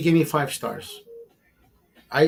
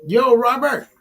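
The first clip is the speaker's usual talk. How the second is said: 1 calling out; 9 semitones higher, 6 dB louder than usual. Real, not acted. Calling out to a friend.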